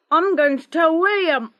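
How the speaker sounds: put-on a cheeky boy's sing-song threat; teasing threat. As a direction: tease her with the threat. Boy threatening to tell.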